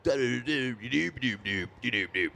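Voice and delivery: a light take on a smooth TV executive; a hint of a laid-back industry dude; slick exec voice. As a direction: lightly imitate the exec's easy schmoozing tone. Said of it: "he talked like that".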